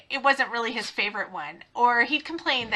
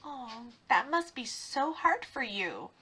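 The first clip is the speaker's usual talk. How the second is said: slow, much softer fake sympathy; mock sympathy; 10 dB quieter, slower than usual. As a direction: soothe him in mock earnest. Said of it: "I would kinda do that".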